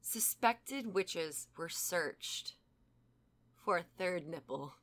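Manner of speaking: slow, suspenseful reveal; dramatic build-up. Real, not acted. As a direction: drag it out for suspense. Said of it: "give this as much build up as I can".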